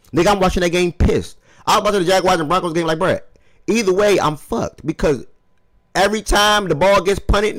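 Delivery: high, loud and fast, fired up; worked-up rant. Real, not acted. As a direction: rant about the game, all heated up. Frustrated rant about the game.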